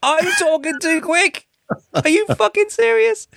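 shrill mock shock at being called too quick; incredulous disbelief. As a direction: squeal it in total disbelief. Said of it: "I was like".

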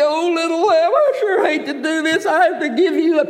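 high, hammed-up weeping over the lamb; mock crying. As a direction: pretend to sob as you give it up. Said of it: "they would start crying".

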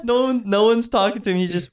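ordinary talk

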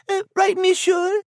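usual speaking voice

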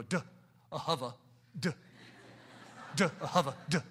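put-on "duh, ahava, duh" sounded out with a laugh, kids-show style; Sesame Street style. Shown as a demonstration. Pastor playfully teaching Hebrew like Sesame Street, laughing. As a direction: spell it out in sounds like a children's TV teacher. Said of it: "if this was a Sesame Street thing".

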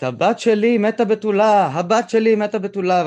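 high, loud anguished cry. Retold or imitated. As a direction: wail it like a grieving mother. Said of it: "את האמא זועקת".

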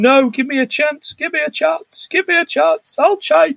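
high, pleading mock cry for another chance; half-hearted mock crying plea. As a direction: beg with a hammed-up whimper, pitched well up. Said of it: "he's crying".

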